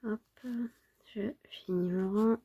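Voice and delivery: sick voice. Real, not acted. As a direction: speak softly, a bit unwell. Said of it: "je suis un peu malade". (voix de malade, un peu fiévreuse)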